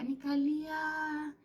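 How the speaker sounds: high, drawn-out mock cry; mock wail. Woman retelling how she cried in the bedroom, mock wail. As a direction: stretch the word out into a playful wail.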